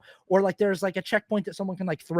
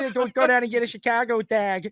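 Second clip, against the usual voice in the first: a higher, louder, hammed-up Chicago accent; mock Chicago accent; 5 semitones higher, 3 dB louder than usual. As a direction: cheer for the Bears like a Chicago local. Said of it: "is all of a sudden like".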